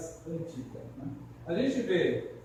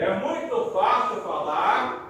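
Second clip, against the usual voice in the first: loud teacher demo; 3 semitones higher, 9 dB louder, slower than usual. Shown as a demonstration. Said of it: "repita o que eu vou dizer".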